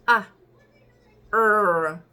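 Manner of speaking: "A, R" as separate sounds, R loud and long; sounded-out letters. Shown as a demonstration. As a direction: sound out "A, R" slowly, one by one. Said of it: "comienza con una Y". ("A, R" sonido por sonido, erre larga)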